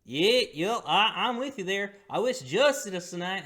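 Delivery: high put-on innkeeper voice.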